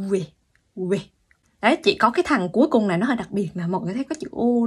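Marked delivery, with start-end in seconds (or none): 0.1-1.0 quê glided from u to e; teacher's model quê; Korean sound quê. Shown as a demonstration. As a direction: demo quê twice. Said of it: "U sang e nó cũng thành quê".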